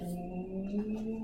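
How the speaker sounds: quiet "nee" for speeding up; a light zoom as the planet speeds back; soft zoom noise. Shown as a demonstration. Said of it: "And then I speed up".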